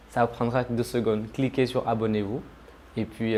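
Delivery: ordinary delivery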